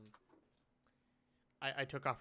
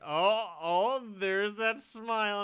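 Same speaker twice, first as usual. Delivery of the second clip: high, loud, drawn-out teasing; teasing cousin; 10 semitones higher, 13 dB louder, much slower than usual. Retold or imitated. Cousin teases a pouting kid, loud singsong-like coaxing. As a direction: needle a sulking kid, stretching it out. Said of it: "he'd just be like".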